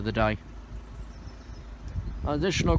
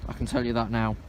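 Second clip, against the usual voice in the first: ordinary talk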